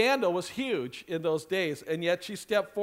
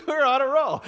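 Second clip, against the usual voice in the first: laughing; 4 semitones higher than usual. Real, not acted.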